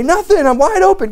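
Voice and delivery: high hammed-up eager answer; eager mock friend. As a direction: play the clueless friend, all too willing.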